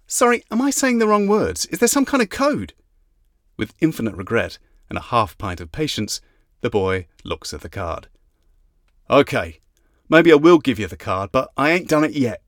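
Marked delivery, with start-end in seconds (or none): 0.1-2.7 exasperated, sharply raised pitch; simmering frustration breaking through; frustrated. Acted. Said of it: "The frustration ... begins to simmer again". 9.1-12.4 put-on cocky London accent; teasing London lad voice; gruff London accent.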